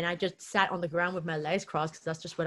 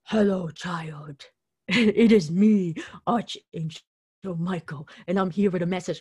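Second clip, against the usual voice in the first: lightly voiced solemn angel greeting a child; archangel voice; 5 dB louder than usual. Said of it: "it was just like".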